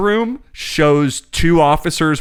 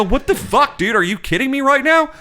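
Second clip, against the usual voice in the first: much higher incredulous cry; shocked outburst; 13 semitones higher than usual. Retold or imitated. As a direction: throw it out in sheer disbelief. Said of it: "And I go".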